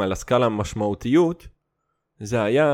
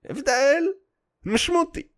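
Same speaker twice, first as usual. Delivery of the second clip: הבדל משמעותי said much higher; emphatic high repeat. Shown as a demonstration. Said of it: "repeats it higher".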